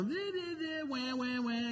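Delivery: high, hammed-up wailing syllables; mocking an overemotional crier with fake wah-wah sobs; mock blubbering. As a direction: parody a weepy crier. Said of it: "super emotional".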